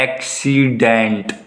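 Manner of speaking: "Accident" very slow, in three drawn-out parts; slow teaching demo of "accident", each syllable held apart. Shown as a demonstration. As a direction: break "accident" into ऐक-सी-डेंट, stretching each vowel. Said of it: "तीन sound आ रही है ना".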